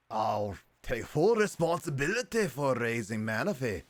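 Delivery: loud, slow, hammed-up Scottish character; bad Scottish accent. Walrein vowing to raise Manaphy, loud bad Scottish accent. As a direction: boom it out in a badly faked Scottish accent. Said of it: "give him a bad Scottish accent".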